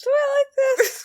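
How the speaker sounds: put-on high laughing sing-song girl voice; giggly Valley Girl squeal; bubbly sorority girl.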